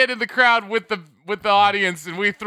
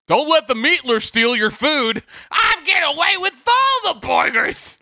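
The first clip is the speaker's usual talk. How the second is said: shouted cartoon villain, higher at the end; evil commercial villain; 7 semitones higher than usual. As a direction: ham it up like a fast-food ad villain. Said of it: "like an evil villain in a Burger King commercial".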